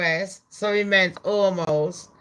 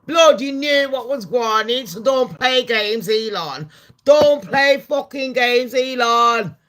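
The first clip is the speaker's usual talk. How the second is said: mock scolding; 5 semitones higher, 6 dB louder than usual.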